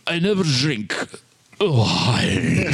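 put-on mumbled strange accent, drawn out; mumbly Dracula accent. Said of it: "weird mumbly accent".

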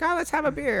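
put-on high, chirpy old lady; grandma voice. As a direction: offer it like a cheerful grandma. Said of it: "She's like".

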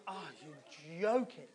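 slow, drawn-out dismay; mock-exasperated groaning words; retold disbelief. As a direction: stretch it out in weary disbelief. Retells dismay when ride halts.

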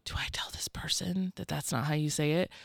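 whispered, as a nervous listener's private worry; panicked inner thought. Retold or imitated. Guest voicing a colleague's silent panic, whispered. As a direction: whisper it like a secret you daren't say aloud. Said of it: "moment of terror and panic and confusion".

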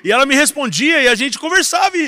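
building from a testimony into a loud, high proclamation; preaching at full force. Acted. Pastor proclaiming God's voice to the congregation, loud and high.

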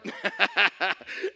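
impish acted laugh; gleeful teasing laugh; mischievous cackle. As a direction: snicker like a brat who got caught. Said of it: "I'm like".

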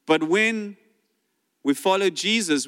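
usual speaking voice

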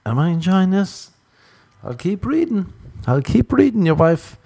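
doubtful inner voice. Retold or imitated. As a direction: shrug and keep going.

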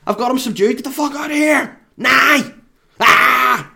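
high, loud frantic screaming, building to a wordless shriek; panicked yelling. Retold or imitated. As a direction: lose it completely, screaming at them to go. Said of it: "But what comes out is".

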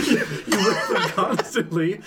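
slow, high, laughing insistence. Real, not acted. Inventor repeats the rules to mocking friends, laughing. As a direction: stretch it out through giggles. Cracking up repeating own line.

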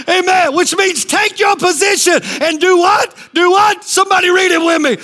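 high, driving sermon shouts; fired-up pulpit cry; full-force preaching. Real, not acted. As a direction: rouse them with rapid-fire exhortation.

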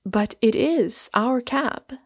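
drawn-out, lifted insistence; persisting. A narrator's reading. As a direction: persist gently, stretching the words. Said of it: "persisted Mary".

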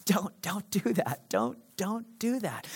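soft urgent whisper; whispered warning. Acted. Preacher voicing God, a whispered warning. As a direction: breathe it out as a gentle caution. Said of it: "God will whisper warnings".

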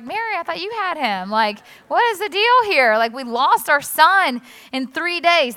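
mock frantic husband, half-hearted; exasperated Joseph. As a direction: toss the blame back at Mary, exasperated. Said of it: "Joseph's like".

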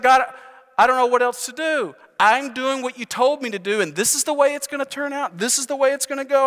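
high-pitched exasperated complaint to God; frustrated. Acted. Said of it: "He's frustrated".

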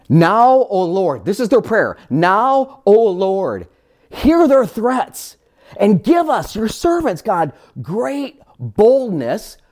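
slow, lifted prayer voice; prayerful reading. Acted. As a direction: pray it out slowly and boldly. Reading the apostles' prayer slowly.

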